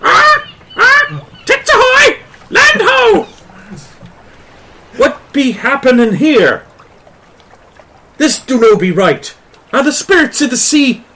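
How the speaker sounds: very high, loud parrot squawk. Acted. Skeleton parrot squawking at the crew, shrill high squawk. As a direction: screech it like a pirate's parrot. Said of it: "The parrot's just like".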